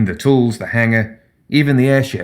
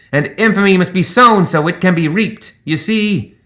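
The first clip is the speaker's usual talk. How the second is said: higher, louder, swelling oratory; pompous gremlin pirate speech; 6 semitones higher, 3 dB louder than usual. A narrator's reading. Zip speechifies to his sky pirates, grandly building. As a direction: preach infamy to the crew like a born showman. Zip's long pirate monologue.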